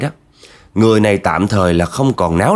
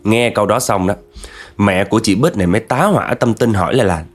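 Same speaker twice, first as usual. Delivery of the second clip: plain reading voice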